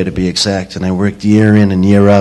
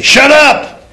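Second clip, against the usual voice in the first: "Shut up!" barked much higher with a sharp "up"; angry shut up. Shown as a demonstration. Comic showing the hostile way to say it, sharply. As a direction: snap "Shut up!" like you mean it. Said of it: "I could say".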